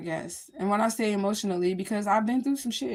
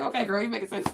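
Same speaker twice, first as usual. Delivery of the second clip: self-praise; 3 semitones higher than usual. Retold or imitated. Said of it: "I be like".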